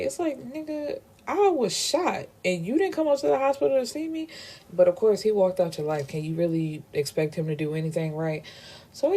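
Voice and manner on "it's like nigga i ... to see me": indignant. Real, not acted. Indignant aside as [name].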